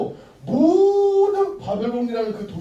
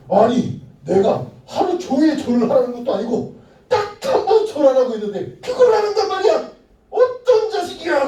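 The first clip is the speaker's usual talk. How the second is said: loud, higher furious king; angry king; 7 semitones higher, 8 dB louder than usual. Acted. Angry king raging about the three who didn't bow. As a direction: rant like an offended tyrant. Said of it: "화가 난 거예요".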